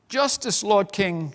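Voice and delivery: high, pleading cry for justice. A narrator's reading. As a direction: beg the king for help. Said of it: "she cried".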